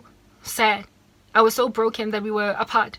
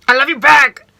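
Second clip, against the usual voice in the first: put-on much louder, higher boyfriend voice on I love you back with a rising end; imitating boyfriend; 4 semitones higher, 13 dB louder than usual. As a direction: mimic his cheerful reply. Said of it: "He used to say".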